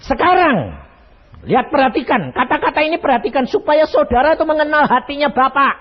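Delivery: God instructing Moses, firm and high; God speaking. Acted. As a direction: command attention. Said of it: "Tuhan berfirman kepada Musa".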